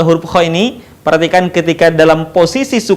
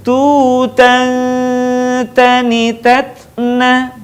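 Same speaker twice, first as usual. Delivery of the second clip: slow chant, long hum ending "Tutan"; held nasal; 3 semitones higher, much slower than usual. Shown as a demonstration. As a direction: hold the n of "Tutan", then continue evenly. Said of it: "Perhatikan sekali lagi". ("Tutan" ditahan dengung, dilantunkan pelan)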